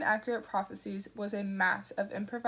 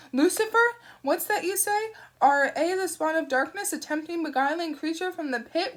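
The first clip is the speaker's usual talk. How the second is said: ranting zealot; 6 semitones higher, 5 dB louder than usual. Acted. Shadwell rants about hellspawn.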